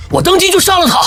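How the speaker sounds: high-pitched, agitated death threat; furious threat. Acted. Hostage-taker threatens to kill.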